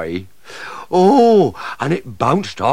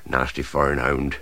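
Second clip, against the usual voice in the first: usual speaking voice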